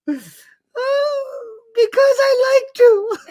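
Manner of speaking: put-on high, slow sobbing child voice; crying child. Daughter answering her mother through mock tears. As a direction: whimper the answer like a hurt toddler. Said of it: "I'm supposed to cry".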